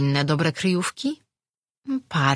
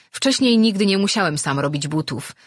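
ordinary delivery

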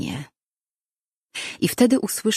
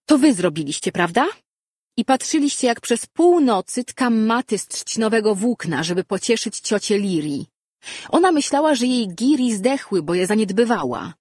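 raised, indignant telling-off; accusing scolding; 6 semitones higher, 4 dB louder than usual. A narrator's reading. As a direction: confront the culprits with rising outrage. Girl accuses and scolds her cousins.

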